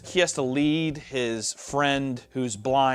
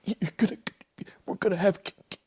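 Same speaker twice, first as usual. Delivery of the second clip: mock freezing Titanic lover; 6 semitones higher than usual. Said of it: "He's like".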